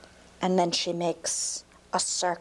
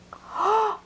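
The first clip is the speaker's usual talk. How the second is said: much higher, louder gasp of amazement; sharp high gasp; 13 semitones higher, 3 dB louder than usual. Acted. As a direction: gasp as the spring bursts out. Water bursts from the mountain.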